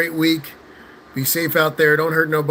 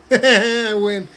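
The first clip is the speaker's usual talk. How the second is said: laughing; 6 semitones higher, 4 dB louder than usual. Real, not acted. Laughing victory over mom.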